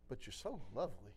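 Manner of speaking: soft, low, loving murmur; imitating a gentle, adoring voice, very quiet; tender reply. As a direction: answer a protest with hushed tenderness.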